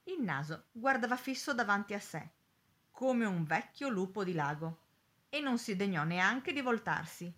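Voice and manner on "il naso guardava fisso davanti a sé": plain reading voice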